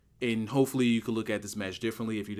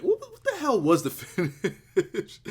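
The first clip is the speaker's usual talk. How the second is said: high, slowed, breaking into a laugh; real laugh at your own blank memory; 8 semitones higher than usual. Real, not acted. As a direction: laugh through the question as it climbs. Laughs at forgetting the finish.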